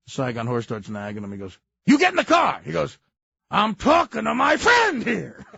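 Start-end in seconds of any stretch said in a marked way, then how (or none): 1.8-2.6 high barked order; mock yell. Farley's character ordering his nagging girlfriend, barking high. 3.5-5.4 slow, raised, indignant; mock yell. Farley's character snapping at his girlfriend, slow and loud.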